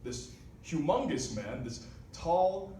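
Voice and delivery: ordinary talk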